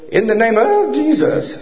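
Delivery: hoarse voice cracking on the words; voice breaking. Retold or imitated. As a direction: push through a failing, breaking voice. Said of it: "Did you notice my voice starting to break?".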